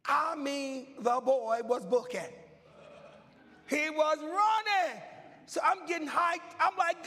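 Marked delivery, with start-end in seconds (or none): 3.7-7.1 higher and louder, hyped and rising; building preaching shout. Acted. Preacher telling the congregation his relay dream, hyped shouting. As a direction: preach it like the race is live.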